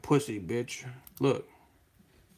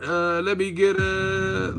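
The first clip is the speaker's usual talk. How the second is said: tipsy drive-thru order; 6 semitones higher, slower than usual. Retold or imitated. Drunk customer at drive-thru.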